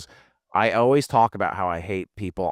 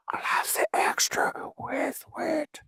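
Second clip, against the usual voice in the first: mock whisper order; 5 dB quieter than usual. Ordering from secret menu.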